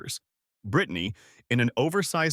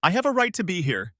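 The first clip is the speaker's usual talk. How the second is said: high, insistent cry; cutting protest; 7 semitones higher, 3 dB louder than usual. A narrator's reading. Sister insisting on her place at the graveside, cutting protest. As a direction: cut through the hush with a raised voice. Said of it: "Brittany's voice cut through the prayer".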